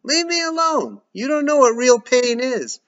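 high loud yell. Acted. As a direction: shout back in pain. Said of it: "from upstairs".

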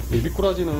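normal talking voice

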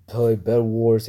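normal talking voice

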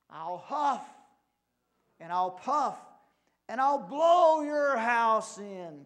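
angry cry. A narrator's reading. Angry wolf threatening the pigs, loud cry. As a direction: build to a big storybook bellow. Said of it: "cried the angry wolf".

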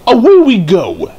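high, loud cheerful call; excited. Acted. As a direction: shout gleefully as you set off. Daisy sets off happily.

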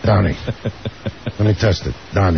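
low, gruff Dice voice. Acted. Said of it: "your voice sounds different".

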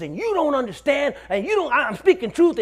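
high-pitched agitated protest; imitated ranting. Possessed man confronts the officer, high agitated ranting. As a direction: pitch it up high, insisting over and over. Possessed man's rant retold.

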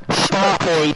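loud put-on voice; silly catchphrase voice. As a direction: belt out the silly nickname in a put-on voice.

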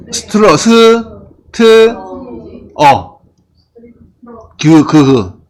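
pronunciation drill, each sound of "struggle" on its own. Shown as a demonstration. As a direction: break "struggle" into separate sounds, str, 스, 트, 어, 그. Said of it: "발음해도 돼요".

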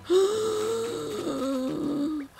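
long, high gasp of delight; high, drawn-out delighted gasp; excited gasp. Real, not acted. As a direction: draw in a huge thrilled gasp. Thrilled by the South Pole item.

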